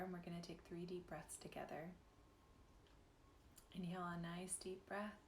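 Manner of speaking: gentle meditation guide with long pauses; guided meditation. Real, not acted. Guide inviting listeners to close eyes and breathe, guided meditation. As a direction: ease them into breath and softening, leaving space between phrases.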